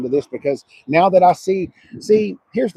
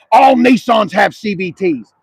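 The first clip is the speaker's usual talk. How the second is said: mocking quote; 4 semitones higher, 7 dB louder than usual. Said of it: "I hear that comment all the time".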